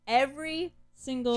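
"Every single" punched high and loud; emphatic insistence. Real, not acted. Fan confessing the film makes them cry, "Every single" loudly stressed.

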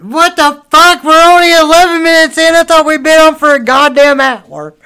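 jumps high and loud, in disbelief; stunned outburst. Real, not acted. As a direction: blurt it out, shocked at how little time has passed. Shocked it is only eleven minutes.

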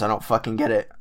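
cracking voice. Real, not acted. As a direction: voice breaks mid-word. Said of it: "Oh, voice crack".

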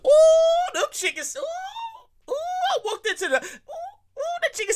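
high falsetto food rapture; swooning oohs. Real, not acted. Host recalling the sandwich, high swooning oohs. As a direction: moan with delight over the taste. Raving about the chicken sandwich.

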